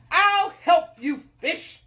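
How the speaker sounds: big, loud, slow royal voice; hungry king. Acted. Hungry king offers the cook help, booming voice. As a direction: announce it grandly, drawing out words.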